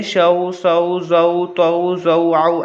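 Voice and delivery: even run of level-toned syllables; syllable recitation. Shown as a demonstration. Teacher showing all are read alike, evenly chanted syllables. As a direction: chant the joined syllables one after another. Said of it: "सबको ऐसे ही पढ़ेंगे".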